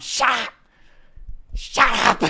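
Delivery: echoing the crowd's shout loudly; mock yell. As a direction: yell it back like the hecklers. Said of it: "imitating crowd yelling shut up".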